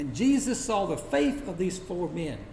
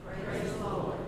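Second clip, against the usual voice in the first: hushed, distant voice of an amazed crowd; 9 dB quieter than usual. Acted. Preacher voicing the awed crowd, hushed and distant. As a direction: murmur it like a crowd far off, in awe. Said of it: "the crowd was amazed and said".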